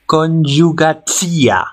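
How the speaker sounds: conyugatsia drawn out slowly, each syllable clear; foreign pronunciation. Shown as a demonstration. Teacher models a Cyrillic-script word, slow and careful. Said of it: "como conyugatsia".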